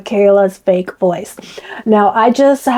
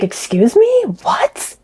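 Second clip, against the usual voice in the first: shocked; 7 semitones higher, much slower than usual. Retold or imitated. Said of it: "I was like".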